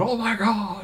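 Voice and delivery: high, drawn-out mock awe; retold amazement. Fan gushing about the show the other night, retold amazement. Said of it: "Baker the other night going".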